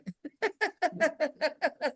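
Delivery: high burst of real laughter. Real, not acted. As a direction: break into laughter at the irony. Laughs at the irony.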